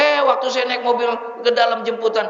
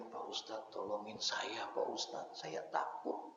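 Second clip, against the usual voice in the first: whispered, much softer, crying plea; tearful whisper; 12 semitones lower, 21 dB quieter, slower than usual. Retold or imitated. Young man weeping on the ustadz's shoulder, whispering. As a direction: whisper it through tears on his shoulder. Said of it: "dia nangis di pundak saya".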